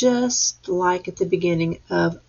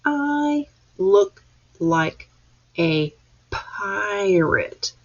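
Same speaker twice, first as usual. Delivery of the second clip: slow pointing read; much slower than usual. Shown as a demonstration. Teacher reading with class: slow, word by word. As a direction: read it slowly, one word per point. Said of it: "read that one more time".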